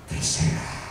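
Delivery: imitated faint, breath-only resignation; weak, exhausted. Exhausted wife surrendering in labour, imitated in a faint whisper. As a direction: give up softly, as if drained. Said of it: "Istri saya lagi lemes, lagi capek".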